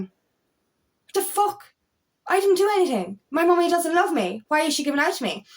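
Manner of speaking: a hint of a confused little child; hurt child. Voicing a bewildered, hurt child.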